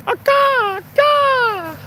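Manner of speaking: high cawing bird imitation; crow caw. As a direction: call like a crow.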